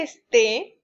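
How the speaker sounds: slow, drawn-out es-TÉ; stress on the last syllable. Shown as a demonstration. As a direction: lean on the final vowel of "esté". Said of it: "cuando yo esté, del verbo estar". ("esté" lento, con acento en la última sílaba)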